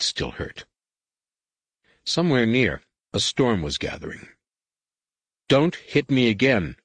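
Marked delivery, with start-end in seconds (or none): 5.5-6.8 stern. Acted. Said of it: "Anakin said sternly".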